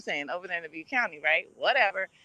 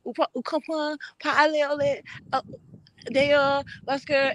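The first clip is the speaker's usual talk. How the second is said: higher-pitched, halting broken Creole; 7 semitones higher than usual. Retold or imitated. Haitian American trying to explain to locals, in broken Creole. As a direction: imitate a faltering attempt at Creole mixed with English. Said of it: "speaking all kind of broken Creole".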